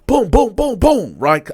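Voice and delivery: high, loud booms mimicking fists on metal; punching sounds. Telling how he punched the bed frame, loud mimicked booms. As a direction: bang it out like punches.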